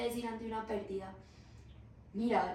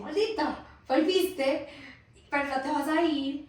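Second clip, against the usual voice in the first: loud, higher-pitched mock outburst of rage; acted anger; 4 semitones higher, 5 dB louder than usual. Therapist acting out a sister's rage at the one who returned, angry. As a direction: play resentment, raised voice and pitch. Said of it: "probablemente sentiste rabia".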